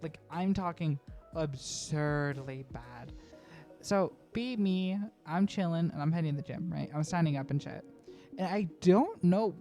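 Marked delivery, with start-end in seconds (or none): none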